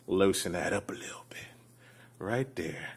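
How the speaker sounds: low, very soft near-whisper; quiet, intimate murmur; hushed whisper. Pretend. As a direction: whisper it softly over the task. Softly narrating while putting lotion on.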